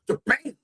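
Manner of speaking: high, punched "Shabam"; imitated impact. Preacher imagining passing out, mock thud. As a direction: burst out a mock crash noise. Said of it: "hit my head and go".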